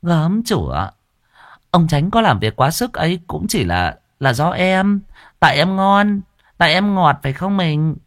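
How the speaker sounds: high, tart, teasing jab; sharp-tongued sass. Acted. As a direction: needle her rival with sugary spite. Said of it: "lại dùng giọng chanh chua lắc lẻo".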